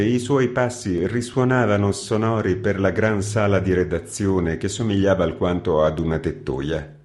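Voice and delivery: usual speaking voice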